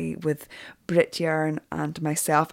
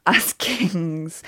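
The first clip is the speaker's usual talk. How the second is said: laughing. Real, not acted. Laughs while quoting.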